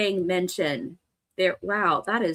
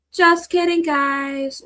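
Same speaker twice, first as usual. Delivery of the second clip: higher, louder playful cry; playful shout; 7 semitones higher, 6 dB louder, much slower than usual. Acted. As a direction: call it out brightly. Repeats joke loudly.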